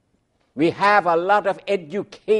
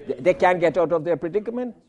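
ordinary talk

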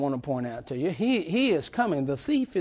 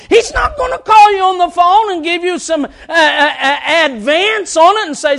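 loud, high-pitched preacher's cry; thundering warning from the pulpit; full-force preaching; 12 semitones higher, 9 dB louder than usual. Real, not acted.

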